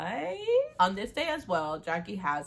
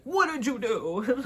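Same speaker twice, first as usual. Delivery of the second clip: higher, louder, slow accusation; slow accusing demand; 7 semitones higher, 7 dB louder, slower than usual. Acted. Brother confronting Cole over the goodbye note, slow accusing demand. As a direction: drag it out, accusing.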